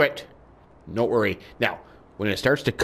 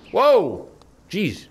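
high, loud whoa; startled; 8 semitones higher, 3 dB louder than usual. Pretend. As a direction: cry out at a sudden jolt. Startled as horse makes a jump.

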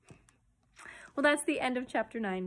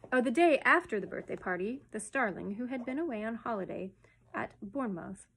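plain reading voice

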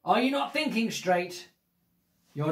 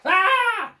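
high loud shriek of alarm; startled shriek; 14 semitones higher, 7 dB louder than usual. Acted. Reader shrieks at the child turning the page.

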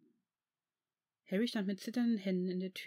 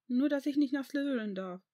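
put-on higher, louder child voice; upset child; 6 semitones higher, 5 dB louder than usual. A narrator's reading. Tearful boy tells Tom what he overheard, upset. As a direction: protest like a hurt little boy, lightly acted.

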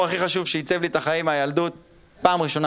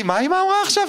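put-on scared kid; 5 dB louder than usual. Sister asking, panicked.

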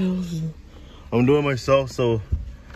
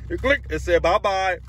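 teasing bye-bye; 10 semitones higher than usual. Pretend. Pretend shifting past cars.

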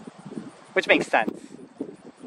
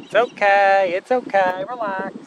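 reassuring the bird; 6 semitones higher than usual. Real, not acted. Soothing the osprey again.

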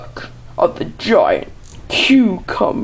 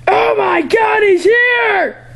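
high, alarmed yell; panicked shout; 11 semitones higher, much slower than usual. Pretend. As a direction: shriek the alarm. Monster arrives.

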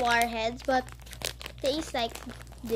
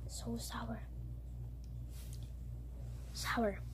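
whispered, strained by sourness; whispering; 4 semitones lower, 16 dB quieter, slower than usual. Real, not acted. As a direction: breathe it out under the sour taste. Sour candy in mouth.

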